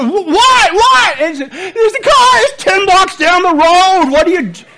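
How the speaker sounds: hammed-up wife yelling at husband driving; mock panicked wife. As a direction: cry out in alarm over a distant car. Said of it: "And then when you're".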